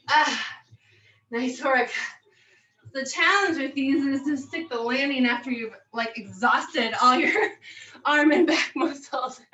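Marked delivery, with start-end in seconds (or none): none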